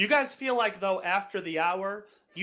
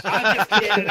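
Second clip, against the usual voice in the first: laughing; 4 semitones higher, 10 dB louder than usual. Real, not acted. Teasing players about initiative.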